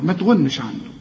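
usual speaking voice

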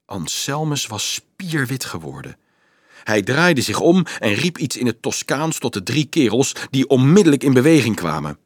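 usual speaking voice

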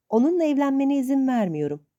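put-on old aunt forbidding the marriage; angry shout. A narrator's reading. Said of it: "diye bağırdı".

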